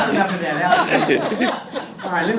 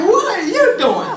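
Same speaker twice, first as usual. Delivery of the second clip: high, loud, scandalized question; shocked disbelief; 11 semitones higher, 5 dB louder than usual. Acted. Passengers to their undressed seatmate, in shocked disbelief. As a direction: blurt it in acted shock.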